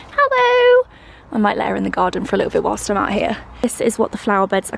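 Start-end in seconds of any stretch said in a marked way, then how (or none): 0.1-0.9 much higher, louder sing-song hello for a pet; cooing at the dog. Real, not acted. She spots her dog Tilly in the garden and coos a high pet-voice hello.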